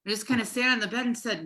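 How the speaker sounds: normal talking voice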